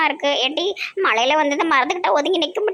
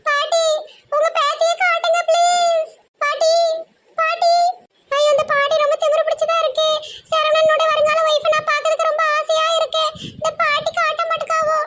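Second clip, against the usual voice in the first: much higher, fussy feminine squeal; high mock woman's voice; 11 semitones higher than usual. Man playing a girl squeals at the grandma running past, high fussy voice. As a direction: pitch it way up like a silly girl.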